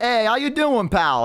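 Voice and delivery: put-on high, chummy Boston accent for a goblin. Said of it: "they also have, like, a Boston accent".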